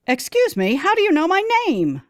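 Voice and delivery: high, alarmed disbelief; startled question. A narrator's reading. Woman asking how the stranger knows her name, startled. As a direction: demand it, thrown and high. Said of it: "I ask".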